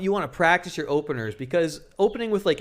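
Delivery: usual speaking voice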